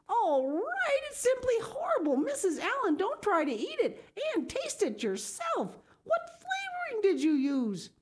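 put-on high, scolding older woman's voice; dismayed Marilla. A narrator's reading. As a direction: scold with alarm.

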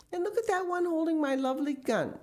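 very high-pitched indignation; spluttering owner's protest, voice shooting up; outraged shout. Acted. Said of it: "shouted Mister Gregg".

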